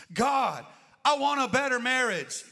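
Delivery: loud, high pleading cry to God; pleading prayer. Retold or imitated. Preacher voicing a believer begging God, loud and high. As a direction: shout it up like a desperate prayer. Shouted prayer for better marriage.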